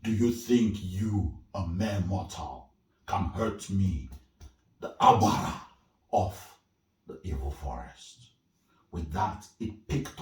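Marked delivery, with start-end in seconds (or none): 0.0-8.2 loud scornful spirit voice; taunting spirit. Acted. Abara of the evil forest taunting Irebu, loud and scornful. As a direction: sneer down at the mere mortal.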